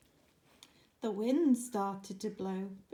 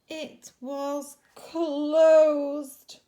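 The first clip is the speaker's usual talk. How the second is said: ordinary delivery, nothing marked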